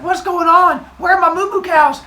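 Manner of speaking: high, loud frantic yell; hammed-up hysterical fury; hysterical anger. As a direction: shout in outraged panic. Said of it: "He's hysterical with anger".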